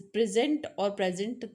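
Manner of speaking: Present first with stress on the first syllable, then present stressed on the second; stress contrast demo. Shown as a demonstration. Teacher contrasting noun and verb present by stress. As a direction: shift the stress in present between its two syllables. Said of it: "कहाँ stress लगाना है".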